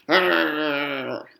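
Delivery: long rolled growl; angry puppy growl. A narrator's reading. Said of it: "make my angry puppy face and growl".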